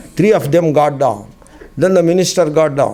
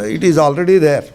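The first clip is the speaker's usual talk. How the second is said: ordinary delivery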